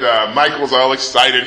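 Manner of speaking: normal talking voice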